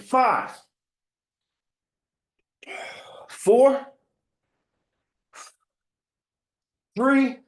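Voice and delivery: high, loud shouted countdown; counting call. Real, not acted. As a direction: bark each number to push the class. Coach calling out countdown.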